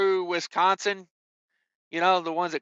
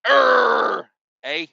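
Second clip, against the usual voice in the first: mad Canadian; 3 semitones higher, 5 dB louder than usual. Retold or imitated. Trucker mocking Canadians over tolls, a louder hammed-up growl. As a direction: bark it out like a furious Canadian. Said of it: "that's what a mad Canadian sounds like".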